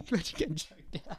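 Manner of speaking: through a laugh. Real, not acted. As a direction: chuckle the words out. Laughing at the fight story.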